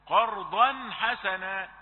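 قرضا حسنا slow with the nun clearly sounded; clear izhar. Shown as a demonstration. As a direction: stress the nun before حسنا. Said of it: "إظهار". (إظهار التنوين في قرضا حسنا)

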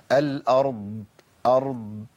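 "الأرض" closing on a sounded, unreleased ض; audible Dad. Shown as a demonstration. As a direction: keep the ض of "أرض" voiced and flowing. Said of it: "لازم الضاد اللي يبقى لها صوت". ("الأرض" بضاد لها صوت)

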